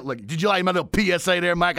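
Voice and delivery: normal talking voice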